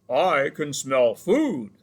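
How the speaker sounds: slow, loud bear voice calling; big hungry bear roars out from the trees; bear yelling. A narrator's reading. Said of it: "yelled a voice from nearby".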